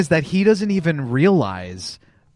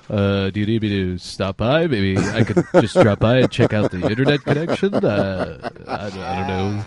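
slow, low, hesitant imitation; Adam impression; 5 semitones lower than usual. Co-host redoing his impersonation on request, hesitant and low. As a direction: put on a halting, noncommittal lower voice. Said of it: "Adam voice impersonation. Do it again".

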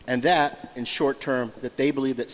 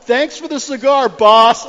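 higher, louder, friendly shouted thanks; mock yell; 11 semitones higher, 13 dB louder, slower than usual. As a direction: call it out from down the alley. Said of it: "he yells out".

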